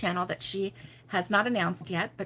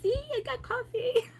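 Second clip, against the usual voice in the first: high, lightly put-on showing-off voice; mock-proud self; 4 dB quieter than usual. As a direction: show off your coffee cup, playfully. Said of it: "I'm like".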